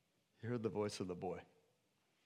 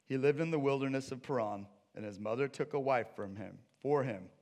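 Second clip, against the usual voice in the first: normal talking voice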